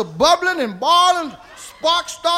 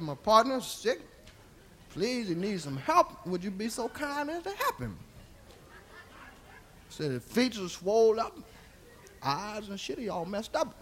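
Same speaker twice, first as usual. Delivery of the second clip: low, hushed, humble request; retold plea, softly and slightly haltingly; polite plea; 4 semitones lower, 12 dB quieter than usual. Explains to her, asking for help.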